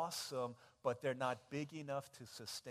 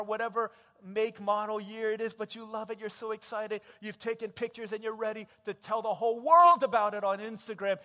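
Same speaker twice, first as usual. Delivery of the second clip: excited; 6 semitones higher, 12 dB louder than usual. Acted. Preacher acts giddy new car.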